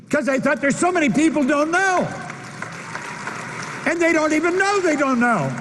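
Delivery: high, ringing motivational oratory; impassioned preaching. Acted. Speaker lamenting people's ignorance to the audience, impassioned preaching. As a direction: preach it with rising urgency, pitched high.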